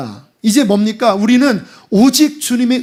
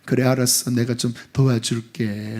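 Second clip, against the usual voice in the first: condescending; 9 semitones lower, 5 dB quieter than usual. Pretend. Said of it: "이렇게 딱 주면서".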